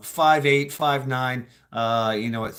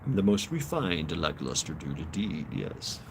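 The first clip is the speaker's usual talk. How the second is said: low, soft voice of refinement; refined gentleman; 8 semitones lower, 6 dB quieter than usual. Pretend. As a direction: play a most refined dude, low and hushed.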